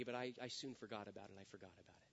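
low, hushed, trailing-off aside; quiet confession. Acted. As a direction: quote it under your breath, sinking away. Quoted confession, dropped to an undertone.

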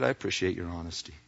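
soft and fast, offhand kindness; quick soft appreciation. Acted. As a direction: toss it off quietly and kindly. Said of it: "He says".

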